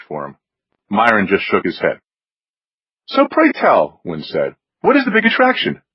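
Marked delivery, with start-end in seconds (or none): 3.1-4.0 high-pitched, arch aristocratic inquiry; haughty snob. A narrator's reading. Wynn pressing his friend about Allie, haughty and high. 4.8-5.8 high-pitched, arch aristocratic inquiry; haughty snob. A narrator's reading. Wynn pressing his friend about Allie, haughty and high.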